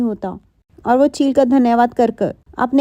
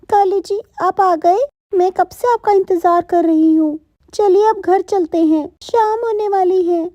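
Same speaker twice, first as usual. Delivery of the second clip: Gauri's eager voice; 7 semitones higher than usual. Acted. Blind wife greeting her husband, high and eager. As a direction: call out happily, thinking he is back. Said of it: "तभी गौरी बोल पड़ती है".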